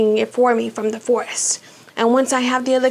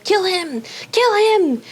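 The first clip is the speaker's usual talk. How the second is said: yelling command; 8 semitones higher, slower than usual. A narrator's reading. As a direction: yell the order, rising to a high shout. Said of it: "Voldemort's yells of".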